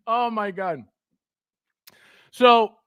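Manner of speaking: usual speaking voice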